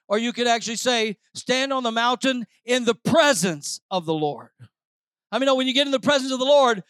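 rising Pentecostal preaching. Acted. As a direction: preach it to the rafters. Preaching at full force.

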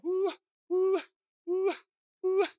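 high hooting call; a second shepherd calling his own flock; shepherd's call. Retold or imitated. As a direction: hoot it to gather the sheep. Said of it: "one shepherd's going this way saying".

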